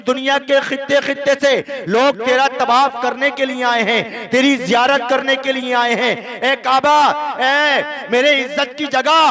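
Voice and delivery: a lightly voiced, lofty proclamation; divine command. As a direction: proclaim the order to the Kaaba with authority. God commands the Kaaba.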